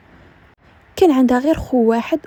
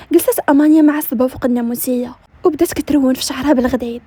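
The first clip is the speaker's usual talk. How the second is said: ordinary delivery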